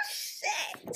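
high giggly laugh. Real, not acted. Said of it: "listener laughs".

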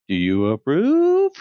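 slow, drawn-out question climbing high; approval-seeking tone. Acted. Podcaster angling for approval from acquaintances, loaded questioning tone. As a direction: stretch it out, fishing for approval. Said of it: "a tone in my voice".

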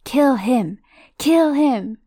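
high, loud repeated yell; Voldemort shrieking as Harry holds on. Acted. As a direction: shout it over and over, frantic. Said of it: "Voldemort's yell".

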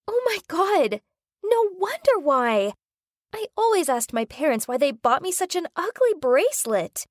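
high, thrilled outburst; excited shriek. Acted. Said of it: "She shrieked".

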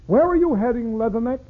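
high-pitched hail to a Marine; hailing question. Acted. Someone hails a departing Marine, high calling question. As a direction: call out the question high, in verse.

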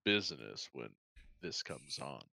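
silky late-night radio announcer; smooth radio voice. Pretend. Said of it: "This is my smooth radio voice".

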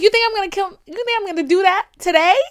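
high, loud disbelief, retold; loud incredulous retort. Repeats incredulous retort to therapist.

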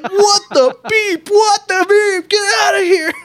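very high, loud laughing yell; high lisping yell. Retold or imitated. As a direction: shriek it through laughter. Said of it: "They're like".

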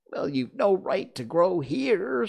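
high, loud grumbling objection; high-pitched indignation; indignant Dormouse. A narrator's reading. Dormouse scolds Alice.

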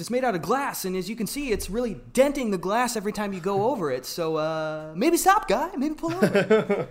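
high goofy fussy warning voice; high mock Fallon voice. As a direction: squeak it like a nervous passenger. Continues Fallon voice, other speaker.

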